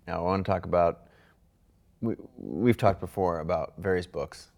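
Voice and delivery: usual speaking voice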